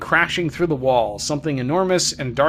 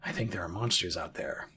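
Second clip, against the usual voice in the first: put-on low, soft voice of a worried kid; hushed confiding; 7 semitones lower, 11 dB quieter than usual. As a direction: confide it quietly, pitched down. Voicing the boy's line in the game.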